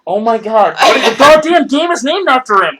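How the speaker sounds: high, loud, slow disbelieving yell; exasperated shout. Real, not acted. Exasperated outburst at friend.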